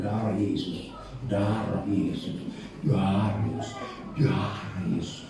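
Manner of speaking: low, soft, slow chant; mock feeble rebuke. Porn watcher rebuking temptation half-heartedly, low feeble mock chant.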